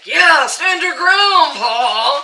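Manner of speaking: loud, slow cheering call; mock shout. Dad and uncle cheering Paul on, loud slow mock shout. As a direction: egg him on loudly. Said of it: "they're like".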